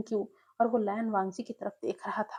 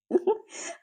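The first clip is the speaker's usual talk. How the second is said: high, breathy burst of laughter; loud laugh; 12 semitones higher, 3 dB louder than usual. Acted. Lan Sui laughs at Wing's complaint, loud high laugh. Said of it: "जोर से हंस पड़ा".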